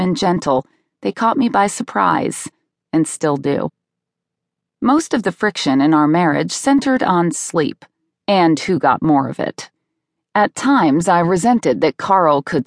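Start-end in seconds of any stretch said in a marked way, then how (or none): none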